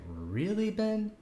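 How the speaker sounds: retold disbelief, rising high on the name; incredulous. Viewer reacting to Ben's dinner remark, incredulous. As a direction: question him as if you can't believe he said it.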